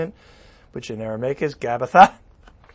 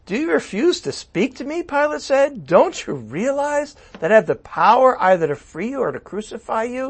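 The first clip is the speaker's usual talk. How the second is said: pressing Pilate; 8 semitones higher than usual. Acted.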